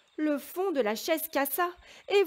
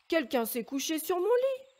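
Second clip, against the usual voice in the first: middle bear's voice; 5 semitones higher, 4 dB louder than usual. A narrator's reading. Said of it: "de sa voix moyenne".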